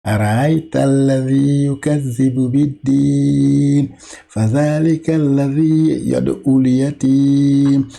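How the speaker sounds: measured chanted verses; Quran recitation. Shown as a demonstration. Teacher rereading the sura aloud in chant. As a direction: recite each verse with melody. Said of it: "Je vais relire encore".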